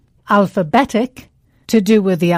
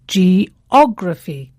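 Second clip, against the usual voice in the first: slow Geography, stress on the OG syllable; much slower than usual. Shown as a demonstration. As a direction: lean on the second syllable of Geography. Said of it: "the stress falls on the third syllable from the end".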